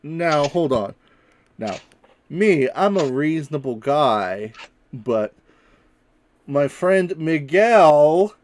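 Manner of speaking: calm him down with a bluff; Lyle bargaining. Acted. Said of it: "and he says".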